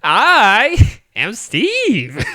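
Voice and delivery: high, slow, hammed-up Steve; drawn-out, high-pitched mock movie delivery; movie line. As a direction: stretch it out high and loud like a movie hero. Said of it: "the movie one's like".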